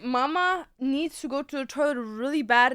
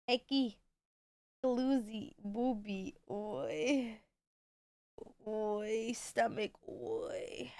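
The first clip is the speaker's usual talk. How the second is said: cooing to the cat; 9 dB quieter, slower than usual. Real, not acted. Streamer cooing softly to her lap cat. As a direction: coo sweetly at the cat on your lap.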